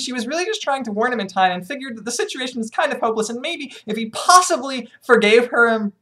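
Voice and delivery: ordinary delivery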